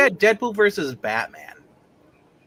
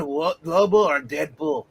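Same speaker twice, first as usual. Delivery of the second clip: usual speaking voice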